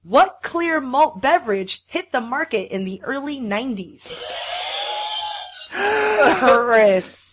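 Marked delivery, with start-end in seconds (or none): 4.2-6.3 sharp high gasp of recognition; excited gasp. Real, not acted. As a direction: gasp like you just remembered.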